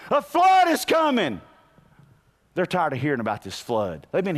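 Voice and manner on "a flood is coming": high, loud warning cry; preaching shout. Retold or imitated. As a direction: call out the flood warning like Noah. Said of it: "He's preaching".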